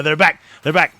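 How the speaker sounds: loud, high hype call; real thrill, full volume; excited shout. Real, not acted. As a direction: yell it like a comeback. Sync returns, hype call.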